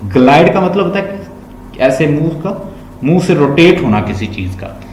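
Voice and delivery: usual speaking voice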